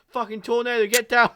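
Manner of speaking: high, loud tornado alarm shout; mock shouted warning. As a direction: act out yelling a panicked warning mid-script. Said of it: "he's just shouting".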